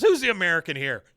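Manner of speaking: high, loud, fast comeback; indignant retort. Acted. Peggy rebuffs a kid's correction, high indignant retort. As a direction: fire back in mock indignation. Teacher snaps back at questioning kid.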